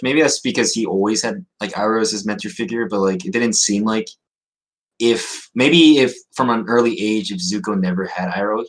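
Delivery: normal talking voice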